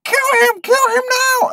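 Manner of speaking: very high put-on screechy order; mock frantic command. Noble girl urges the gremlin on mid-fight, mock frantic squeal. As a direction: squeal it as a hammed-up urgent command.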